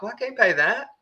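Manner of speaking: high, strained mock protest; voicing a furious pensioner who cannot pay; livid old man. Old man on fixed income telling the clerk, high and livid. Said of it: "the guy's just livid".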